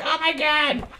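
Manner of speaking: hammed-up squeaky alarm; mock panicked gasp. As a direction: imitate a woman waking in a panic. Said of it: "you know, she's like".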